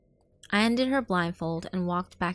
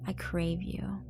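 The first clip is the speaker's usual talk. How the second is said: breathy intimate whisper; hushed seductive whisper; 2 semitones higher, 6 dB quieter, slower than usual. Acted. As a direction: whisper it like a secret. Whispered intimate phone line.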